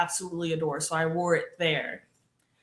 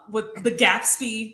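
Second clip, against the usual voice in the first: ordinary talk, nothing marked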